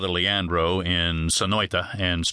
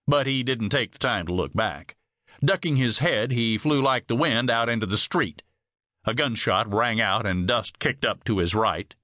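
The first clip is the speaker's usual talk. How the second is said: usual speaking voice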